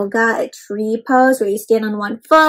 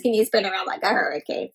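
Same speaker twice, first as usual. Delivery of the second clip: ordinary delivery, nothing marked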